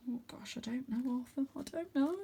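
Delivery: whispered nervous worry; fretting under his breath; scared whisper. A narrator's reading. Wyn fearfully doubting they will fit, whispered.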